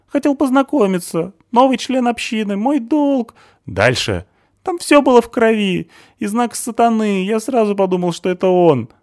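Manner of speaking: high, loud accusing voice of a panicky man; Stasik's shrill accusation. A narrator's reading. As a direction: point the finger and shout it high.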